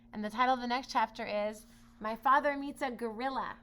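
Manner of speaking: plain reading voice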